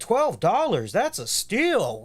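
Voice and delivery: high, gushing fake amazement; hammed-up delighted shopper; mock bargain delight. Acts out naive bargain hunter.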